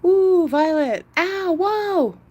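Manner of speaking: mock alarmed cry, high and slow; a hammed-up pretend yelp. Shown as a demonstration. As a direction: lure the dog with a faked yelp. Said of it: "Especially if I say".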